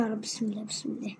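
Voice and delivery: hushed whisper to God; whispered prayer. Real, not acted. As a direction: whisper it under your breath. Nervous prayer before a draw.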